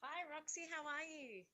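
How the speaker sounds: faint, distant phone voice with hiss; quiet voice loud hiss. Real, not acted. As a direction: speak very softly, far from the mic. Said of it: "You're really quiet".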